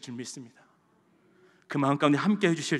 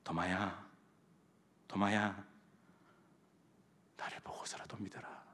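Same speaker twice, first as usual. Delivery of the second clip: very soft, low voice fading to a whisper; 예수님의 나직한 부름; 8 semitones lower, 13 dB quieter than usual. Acted. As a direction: call to a doubter tenderly, almost whispering. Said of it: "그리고 도마에게". (낮게 속삭이듯 부르는 소리)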